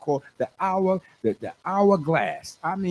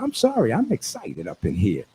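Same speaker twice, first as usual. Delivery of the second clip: ordinary talk, nothing marked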